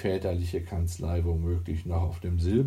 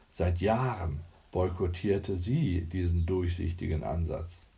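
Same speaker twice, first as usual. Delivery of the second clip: ordinary delivery, nothing marked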